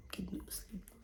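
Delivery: very soft, fading near-whisper; hushed coaxing. Retold or imitated. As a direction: lull a baby in a near-whisper. Retells settling baby to sleep.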